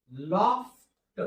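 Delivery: teacher sounding out loft for pupils; drawn-out word. Shown as a demonstration. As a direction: stretch loft slowly to show its sounds. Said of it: "loft म्हणजे काय?".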